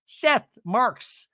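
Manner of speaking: normal talking voice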